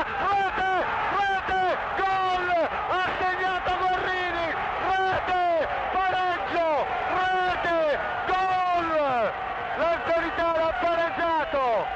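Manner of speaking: high excited goal shouts; goal cry. Real, not acted. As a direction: erupt as the ball hits the net. Goal scored, commentator exults. (urlo del gol)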